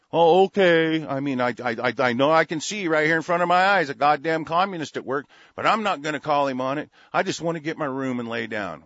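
loud sarcastic voice of a meek bystander; mock the timid crowd, loudly. Host mocking bystanders who stay silent, loud sarcastic. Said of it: "zombies coming in behind me".

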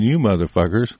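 ordinary talk

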